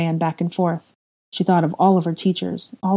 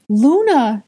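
high, loud call of her name; cried out; 8 semitones higher, 6 dB louder than usual. Acted. Ginny loudly calling out to Luna. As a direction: call out to a friend arriving. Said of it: "Ginny cried".

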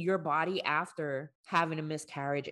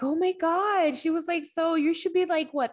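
much higher, louder gushing nurse impression; excited nurse; 14 semitones higher, 5 dB louder than usual. Imitating the ultrasound nurse greeting her, squealing high and loud. Oblivious nurse voiced in retelling.

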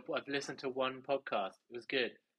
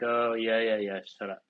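dismissive retort; 6 dB louder than usual. Retold or imitated. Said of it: "She's like".

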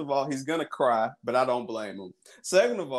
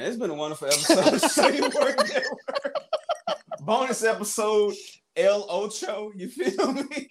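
higher laughing sign-off; laughing outro; 8 semitones higher than usual. Real, not acted. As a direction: wrap the show up laughing.

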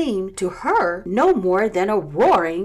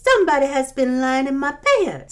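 middle-sized bear; 7 semitones higher, 5 dB louder, faster than usual. A narrator's reading. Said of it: "in her middle-sized voice".

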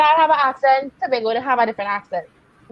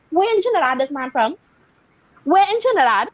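high, disbelieving outrage; rising indignant question; incredulous; 5 semitones higher than usual. Retold or imitated. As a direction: demand it in shocked disbelief. Outraged at fake Trini accent.

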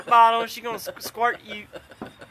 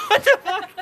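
high, loud startled outcry; startled shout; 11 semitones higher, 6 dB louder than usual. Real, not acted. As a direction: blurt it out in shock. Startled outburst.

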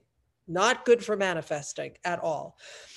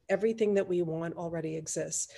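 softer, lowered, even delivery; quiet repeat; 3 semitones lower, 6 dB quieter, faster than usual. Real, not acted. Host repeats the key belief to let it land, quietly. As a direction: let it settle softly after the emphasis. Same point repeated softly.

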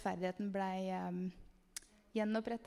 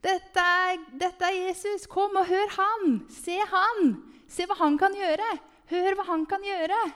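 high, loud rallying shout; calling out; 9 semitones higher, 7 dB louder than usual. Retold or imitated. Dancers calling bystanders to come to Jesus, shouted out high. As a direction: shout an eager invitation to a crowd. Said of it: "og vi roper".

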